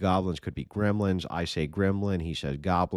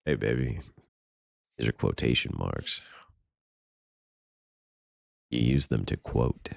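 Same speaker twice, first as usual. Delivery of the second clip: lower, smooth pickup-line voice; 6 semitones lower than usual. Pretend. Mocking a pickup line, said low and smooth to a woman. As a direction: seduce her with a deep purr. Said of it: "one-liners to pick up women".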